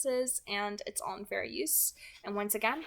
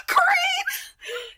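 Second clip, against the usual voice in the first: much higher and louder, a shriek through tears; crying shriek; 9 dB louder than usual. Acted. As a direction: scream for help, sobbing. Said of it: "Edith shrieked".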